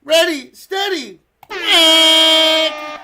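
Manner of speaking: high, loud starter's call; loud call ending in a long quack; race start call. Pretend. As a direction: count them off, then belt the quack.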